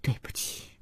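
whispered apology; barely audible, breath-only murmur; low voice. A narrator's reading. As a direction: say it very softly at parting. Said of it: "何建国也低声地说".

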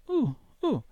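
high, hooting "uh, uh" from a puzzled imp; a small creature caught off guard, squeaking high; baffled little nightmare. A narrator's reading. Nightmare imp lost for words at the balcony, high baffled hoot. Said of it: "domandò l'incubino".

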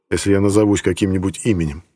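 plain reading voice

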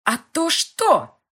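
high, loud, slow taunt; defiant girl mocking his threat; defiant challenge. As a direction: dare him to do it.

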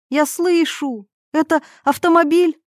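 high, thrilled exclamation; excited, hopeful and high-pitched; joyful cry. A narrator's reading. Irina to Yuri on hearing a car, joyful. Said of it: "радостно закричала она".

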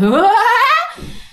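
high, eerie rising ghost cackle; ghostly laugh. Retold or imitated. The ghost laughs after challenging the brother, eerie high cackle. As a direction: cackle like a movie demon, climbing up high. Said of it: "cái giọng cười y như là ma quỷ ở trên phim".

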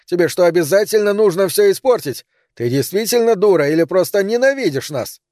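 high-pitched, furious accusation; angry outburst. A narrator's reading. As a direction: lash out at her in exasperation. Romano quarrels with his mother.

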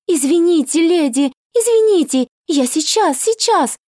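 high, frantic rushed apologies; frantic apologizing. A narrator's reading. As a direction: apologize in a panic. Frightened maid bows, apologizing.

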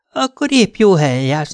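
high-pitched voicing of a teasing lover; flirty woman's voice. A narrator's reading. Cindy teases her lover in bed, flirty woman's voice. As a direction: lift the pitch for a coy woman.

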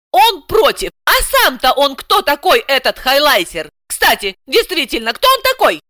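high-pitched, challenging questions; puzzled indignation. A narrator's reading. Housewife wondering who her opponent is, puzzled and indignant. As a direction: shift from outrage to sudden puzzlement. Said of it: "озадачилась Александра".

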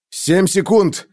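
urgent call. A narrator's reading.

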